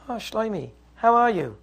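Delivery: soft, pleasant greeting; suddenly calm. Acted. Quarrelling husband greets Shlomi at the door, suddenly soft and calm. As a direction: greet a visitor sweetly, all anger dropped. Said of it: "everybody all of a sudden is calm".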